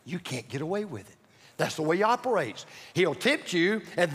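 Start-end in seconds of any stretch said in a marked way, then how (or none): 0.0-1.2 low, hushed voice at the ear; whispered accusation. Acted. Devil turning on the sinner in the left ear, hushed whisper. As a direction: drop it to a soft, low murmur. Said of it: "he'll whisper in your left ear".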